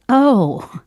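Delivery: imitated dawning realization; a loud "oh" as it sinks in; sudden realization. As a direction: play the penny dropping. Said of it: "it hit her like a ton of bricks".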